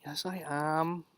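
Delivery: drowsy, slow mumble; slow sleepy mock protest; sleepy denial. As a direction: mumble it half asleep. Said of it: "not even watching the movie".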